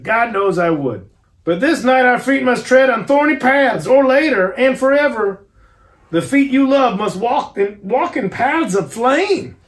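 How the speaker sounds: put-on a stern old doctor foretelling hardship; solemn professor. A narrator's reading. Van Helsing warns Arthur.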